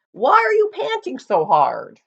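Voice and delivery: put-on a cheerful old donkey calling out; friendly donkey. Donkey voice in the story.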